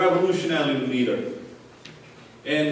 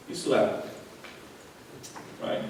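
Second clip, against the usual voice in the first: ordinary talk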